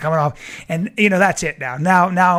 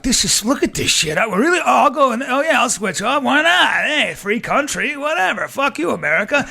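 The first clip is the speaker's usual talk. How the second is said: hyped-up; 7 semitones higher, 5 dB louder than usual. Retold or imitated. Wild bragging inner monologue.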